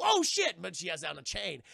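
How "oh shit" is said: much higher, much louder startled yelp; alarmed cry. Retold or imitated. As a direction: catch yourself in a panic. She nearly drops medallion.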